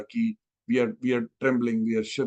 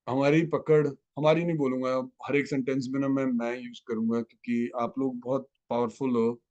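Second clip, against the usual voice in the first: usual speaking voice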